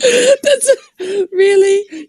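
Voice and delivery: high, laughing disbelief; laughing while talking. Real, not acted. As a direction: laugh it out in surprise. Surprised by the beard bet.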